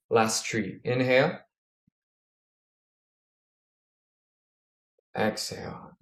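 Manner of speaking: calm breathing coach cues; guided breathing. Acted. Leads a breathing exercise.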